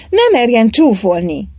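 put-on a young woman's angry outcry; shouting. A narrator's reading. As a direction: shout back at the mockery. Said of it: "kiáltotta Anna".

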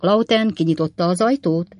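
ordinary delivery, nothing marked

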